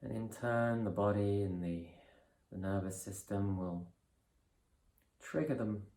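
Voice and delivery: calm, measured guidance with long pauses; guided meditation. Real, not acted. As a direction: ease them through each breath, unhurried and softening. Leads a breathing meditation.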